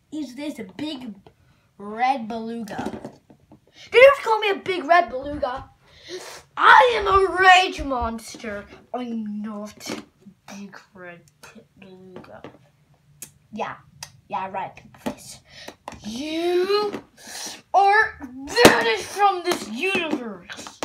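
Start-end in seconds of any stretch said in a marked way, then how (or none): 3.9-8.6 much louder, higher furious yell; fast furious monster shout; angry monster roar. Acted. 16.1-20.8 higher, louder slow proclamation; slow thunderous sentence; booming decree. Acted. Monster decrees banishment.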